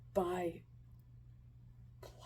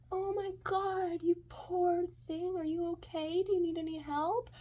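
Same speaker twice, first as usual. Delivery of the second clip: much higher, gushing concern; gushing drunk woman; 10 semitones higher than usual. Retold or imitated. Tipsy stranger to a scared kid, gushing concern. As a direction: fuss over a lost child in a high voice. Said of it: "she turns to me and she goes".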